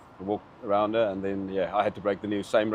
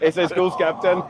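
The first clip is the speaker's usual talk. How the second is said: laughing; 7 semitones higher, 5 dB louder than usual. Real, not acted. Laughing brag.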